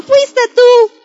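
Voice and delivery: put-on high-pitched child's accusation, falling at the end; accusing child. One playing child blames the other, accusing. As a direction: point the finger, pitched well up. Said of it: "uno le dice al otro".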